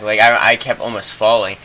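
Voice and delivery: normal talking voice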